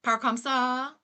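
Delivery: imitated Louisiana French twang; Cajun French accent. Streamer imitating a Louisiana TikTok guy, twangy Cajun French. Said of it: "pretty much just that accent".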